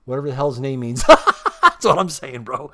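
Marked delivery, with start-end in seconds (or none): 1.1-1.6 loud laugh. Real, not acted. Burst of laughter at himself. 1.6-2.7 laughing. Real, not acted. Laughing while repeating himself.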